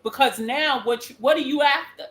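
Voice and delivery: ordinary talk, nothing marked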